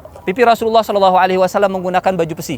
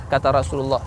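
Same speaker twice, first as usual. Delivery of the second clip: normal talking voice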